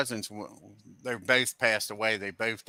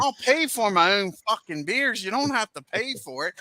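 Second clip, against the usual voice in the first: higher, mock-indignant comeback; retold retort; 9 semitones higher than usual. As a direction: fire it back at your brother, hammed-up. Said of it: "I told him".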